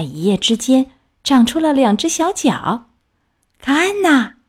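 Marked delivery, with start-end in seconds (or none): 3.6-4.3 high, crowing show-off cry; proud, boastful. A narrator's reading. Tadpole showing off new legs to its friend, boastful. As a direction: show off triumphantly, pitched high. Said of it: "它得意地说".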